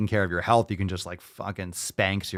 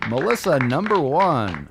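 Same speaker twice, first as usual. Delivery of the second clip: game show announcer; 5 semitones higher than usual. Acted. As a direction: call her up to the main stage. Announcing contestant like a host.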